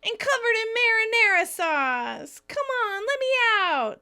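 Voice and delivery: high, loud pleading call; calling out to be let out. Retold or imitated. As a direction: beg loudly to be let out, pitched high. Monica locked in, calling out.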